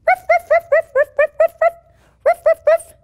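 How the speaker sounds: puppy barks. Shown as a demonstration. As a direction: bark like an excited little puppy. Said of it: "let's make some puppy dog sounds".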